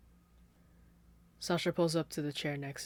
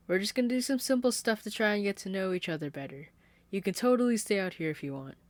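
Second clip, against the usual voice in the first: high, louder sugary reassurance; sweet sing-songy; 6 semitones higher, 6 dB louder, faster than usual. Acted. Caitlin reassuring guests from the table, sweet sing-song. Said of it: "the sweet, sing-songy way".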